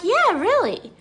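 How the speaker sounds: high-pitched retold comeback; mock insistent retort. Acts out her retort to Shannon.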